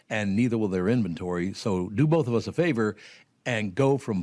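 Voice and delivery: upbeat radio commercial read. Acted. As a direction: pitch the boats like a radio ad. Host reads boat ad.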